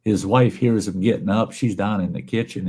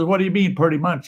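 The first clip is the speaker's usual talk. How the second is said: higher disbelieving doctor; incredulous doctor; 9 semitones higher, faster than usual. Acted. Doctor questioning the patient, higher and incredulous. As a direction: press him in disbelief.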